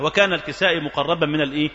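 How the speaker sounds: ordinary talk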